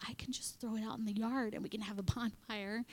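whispered scheming aside; conspiratorial whisper. Retold or imitated. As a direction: whisper it like a naughty secret. I was like.

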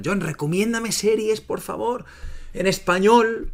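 high pleading student voice; imitating students asking. Students asking him for series.

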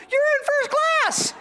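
very high, slow squeal; excited little girl. Retold or imitated. Little girl telling passenger the news, ecstatic. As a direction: imitate a child squealing with delight.

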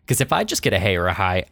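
normal talking voice

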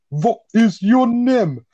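loud, slow mock-German accent; hammed-up German, drawn out and high; Albert. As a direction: bellow it word by word in a bad German accent, pitched high. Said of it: "I can speak a bit of German".

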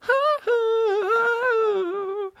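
very high sung wordless note; imitated soul falsetto, long and wavering. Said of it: "Who's that fucking, uh, [name] guy?".

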